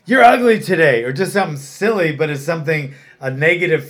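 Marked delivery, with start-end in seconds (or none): 0.0-1.0 loud, higher mocking jab; taunting insult. Kid taunting another kid, loud and mocking. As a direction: sneer it at a rival. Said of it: "say things to each other like".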